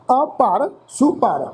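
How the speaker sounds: "ਅਪਰ super" said slowly, each syllable drawn out; slow careful recitation. Shown as a demonstration. Teacher showing students how to say "ਅਪਰ super", slowly. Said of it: "ਹਲਕਾ ਜਿਹਾ pose ਦੇਵਾਂਗੇ ਜੀ".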